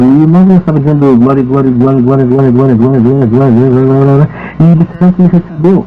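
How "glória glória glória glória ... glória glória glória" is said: glória repetido sem parar, em cadência monótona; repetição rápida. Retold or imitated. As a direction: imitar alguém repetindo depressa a mesma palavra. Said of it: "aumentando o número de vezes rápido". (repetição rápida e monocórdica)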